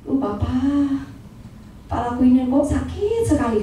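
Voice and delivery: quiet, slow, pained report to the Father; soft weary complaint. Retold or imitated. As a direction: say it slowly, hushed, like a headache. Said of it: "saya cuma ngomong". (pelan dan lirih, seperti kesakitan)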